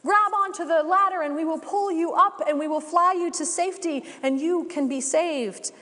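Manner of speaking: high, urgent shout over the rotor noise. Acted. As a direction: yell down from the helicopter, urgent. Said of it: "they shout down".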